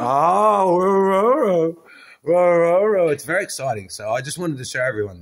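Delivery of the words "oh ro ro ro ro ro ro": high, loud playful ro-ro-ro; playful high dog sounds; mock dog woof. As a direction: bark like an excited pup. Mimicking excited dog noise.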